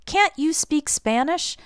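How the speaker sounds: high, slow, puzzled question; surprised checking question. Acted. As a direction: ask as if you had assumed yes. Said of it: "Because I assumed".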